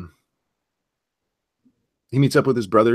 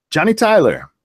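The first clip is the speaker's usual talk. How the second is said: called out high and loud; calling out; 9 semitones higher, 5 dB louder than usual. Retold or imitated. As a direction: call his name across the room. Quoting Doc calling out.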